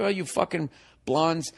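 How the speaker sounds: ordinary talk, nothing marked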